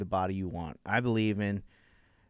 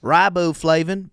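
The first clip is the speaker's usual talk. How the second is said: loud, drawn-out Southern ingredient reading; put-on Southern twang, high and slow; Southern accent; 7 semitones higher, 8 dB louder than usual. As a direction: read the label like an outraged Southerner. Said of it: "I'll read 'em in a Southern accent".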